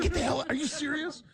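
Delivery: high-pitched, laughing disbelief; incredulous. Retold or imitated. Retelling his reaction to the surprise offer, incredulous. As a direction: react in stunned, high-voiced amazement.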